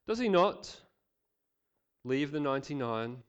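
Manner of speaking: measured, clear reading of the parable; scripture reading. Acted. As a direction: read the passage to the congregation, rising on the joyful lines.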